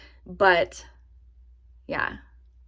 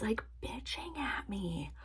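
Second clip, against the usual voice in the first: whisper; 2 semitones higher, 14 dB quieter, slower than usual. Real, not acted. Complaining about boyfriend.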